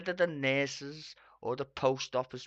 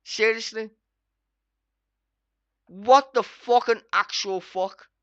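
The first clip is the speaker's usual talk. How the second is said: loud, higher, fed up; exasperated yell; 5 semitones higher, 9 dB louder than usual. Real, not acted. Vlogger raging at MPs' pay, exasperated yell. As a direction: throw it at the screen. Furious rant about pay.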